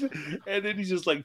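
ordinary talk

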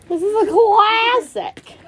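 high, slow parroting with CLASSIC stretched out; teasing, exaggerated imitation; mocking echo. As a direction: mimic a scandalized friend, dragging out CLASSIC. Mimics the friend's exclamation back.

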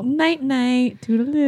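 high, cutesy goodbye; playful sign-off. Real, not acted. Signing off to the listeners, high playful goodbye.

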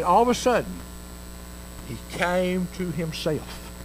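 ordinary talk, nothing marked

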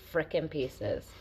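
ordinary talk

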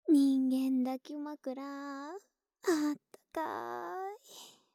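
slow, stretched-out words; stretched, cozy sigh of warmth; drawn-out cozy. Acted. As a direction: savor each word, slowly and contentedly.

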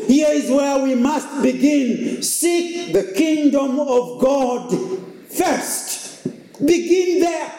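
a pastor preaching at full stride, rising and falling. Acted. Pastor preaching to the congregation, earnest. Preaching a sermon on Psalm 121.

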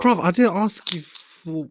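loud, high-pitched protest; mock indignation. Real, not acted. Host telling off the guest, mock indignant. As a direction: drag it out, loudly affronted.

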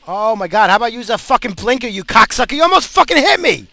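real screaming outburst, loud and high; furious road-rage yell. Real, not acted. Driver almost hit by someone with no blinker, furious scream. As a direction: scream at the driver, climbing to a peak.

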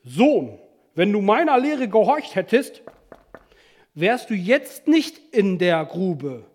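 loud, emphatic scolding teacher; weighty reproach, forcefully spaced out; stern Confucius. Acted. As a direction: lecture a disobedient son sternly. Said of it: "sagte mir in strenger Miene".

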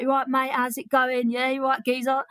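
exaggerated Brit accent, playing to the crowd; hammed-up British accent. British comic charming Australian audience, hammed-up British accent. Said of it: "I just hammed up the accent".

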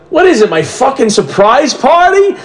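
high, stunned disbelief; incredulous. Retold or imitated. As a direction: sound floored as it dawns on you. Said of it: "I'm like".

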